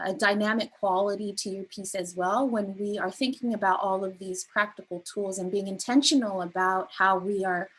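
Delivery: usual speaking voice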